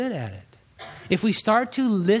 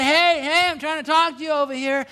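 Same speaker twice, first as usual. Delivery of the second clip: calling out to flag down; 9 semitones higher than usual. Pretend. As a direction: wave them down with your voice, hey hey. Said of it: "trying to flag you down and say".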